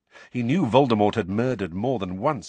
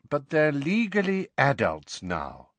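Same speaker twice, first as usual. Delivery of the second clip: tired; 7 semitones higher than usual. A narrator's reading. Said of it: "in the same tired voice".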